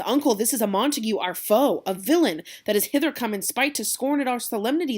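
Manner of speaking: loud angry nephew accusing the intruder; Tybalt outraged. Acted. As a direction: warn the uncle in a fury. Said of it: "Tybalt says".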